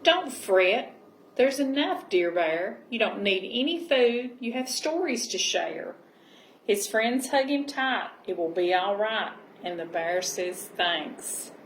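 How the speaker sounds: gentle storybook reading voice; cosy read-aloud storytelling; reading to children. Acted. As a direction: read it like a bedtime picture book. Reading picture book aloud.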